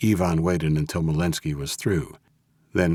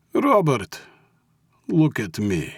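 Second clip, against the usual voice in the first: name pitched high, then falling; coaxing Russian mob boss; 7 semitones higher, 3 dB louder, slower than usual. A narrator's reading. Mob boss makes his captive look up, coaxing. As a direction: coax him in a Russian accent.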